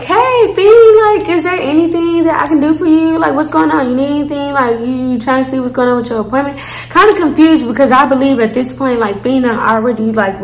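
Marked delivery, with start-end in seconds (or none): 0.0-6.6 high, bright-toned sweet customer service voice; sugary helpful clerk greeting a visitor. Retold or imitated. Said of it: "my best friendly, you know, customer service voice".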